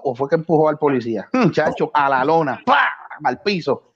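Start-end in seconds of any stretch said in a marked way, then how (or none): none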